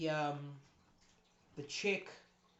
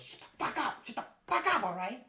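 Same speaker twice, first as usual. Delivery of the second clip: harsh hissed yell; 8 semitones higher than usual. Acted. Guard leader silences his partner, harsh hissed yell. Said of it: "And he goes".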